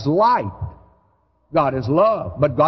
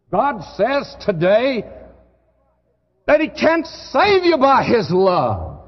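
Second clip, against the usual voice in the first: higher, slow and forceful; fervent preaching; 7 semitones higher than usual. Real, not acted. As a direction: proclaim it with full conviction. Preaching climax about God's love.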